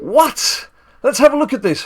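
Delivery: high, loud startled exclamation; surprised. Real, not acted. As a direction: jump in shock at the news. Startled reaction to the card.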